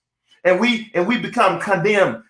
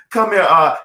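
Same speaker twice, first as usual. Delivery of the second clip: ordinary talk, nothing marked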